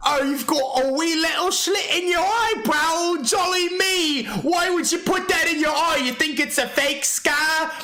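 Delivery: high, drawn-out mocking impression; slow, exaggerated taunting voice, pitched well up; Daz. Streamer replaying Daz's comeback to ridicule him, mocking impression.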